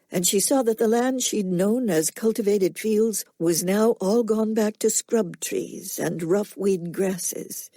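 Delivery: usual speaking voice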